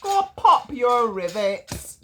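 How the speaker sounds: loud, slow indignant cry; cranky train yelling; shouted. Acted. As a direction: shout it like a cross engine. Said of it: "shouted Duffy".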